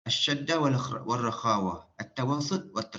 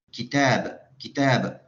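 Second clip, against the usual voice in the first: "kitab" with the second syllable "tab" punched louder and drawn out; stress within kitab; 5 dB louder, much slower than usual. Shown as a demonstration. Teacher asks students where the stress falls, stressed "tab" in "kitab". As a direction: lean hard on the long "tab" in "kitab". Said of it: "So, kita tekan kitab tu pada".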